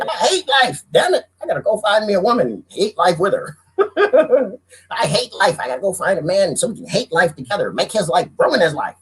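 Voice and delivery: high, whiny moaning; hammed-up gloomy whiner; mock miserable grump. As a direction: grumble like you hate everything. Imagined miserable person seeking partner.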